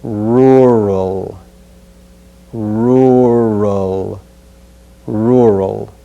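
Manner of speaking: "Rural" slow, with both r sounds clear; careful rural. Shown as a demonstration. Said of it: "Listen again".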